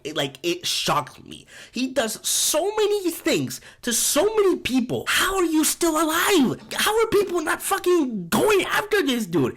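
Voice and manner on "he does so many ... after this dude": much higher, disbelieving outburst; incredulous. Real, not acted. Ranting to viewers about Oz surviving, incredulous. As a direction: ask it like it makes no sense.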